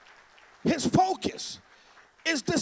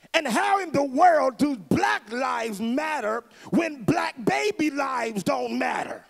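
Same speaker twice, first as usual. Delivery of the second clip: slower, every word leaned on; slow emphatic question. Real, not acted. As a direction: drive the question home slowly. Rhetorical question to the crowd.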